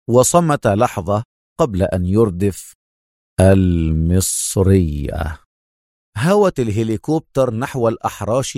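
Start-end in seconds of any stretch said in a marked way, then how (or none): 3.4-5.4 very slow, low single word; deliberate, lowered, drawn-out delivery; chillingly calm. A narrator's reading. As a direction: draw the word out after a pause. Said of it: "أجاب في هدوء أصابها بالذعر".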